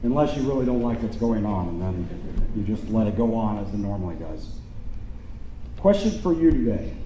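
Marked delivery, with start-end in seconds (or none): none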